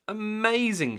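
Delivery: high delighted cheer. A narrator's reading. Missus Grinling cheers the surfer, high delighted cheer. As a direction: cheer him on. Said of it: "she cheered".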